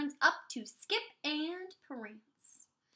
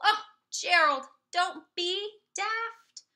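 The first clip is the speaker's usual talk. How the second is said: teasing animal; 5 semitones higher, 8 dB louder, slower than usual. Acted. Animals tease Gerald.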